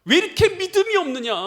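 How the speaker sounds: loud, high-pitched preacher's rebuke; rebuking. Acted. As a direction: thunder it out as a reproach. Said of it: "주님께서 책망하시는 말씀이죠".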